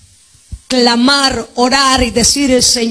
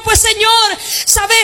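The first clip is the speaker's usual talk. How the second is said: high shouted plea of a weary believer; desperate plea; 9 semitones higher than usual. Acted. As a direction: cry out as if ready to give up. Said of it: "Ahí dirá". (el creyente cansado clama a gritos al Señor)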